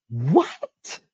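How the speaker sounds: mock shocked gasp. Shocked at the news.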